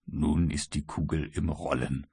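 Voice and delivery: the American murmuring to himself, satisfied; murmured. A narrator's reading. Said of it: "murmelte der Amerikaner".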